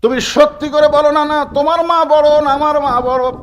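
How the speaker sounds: high, chanted plea to a grandfather; acted child's pleading question. Hossain নানাকে প্রশ্ন করছে. (নানার কাছে চড়া সুরে আবদার)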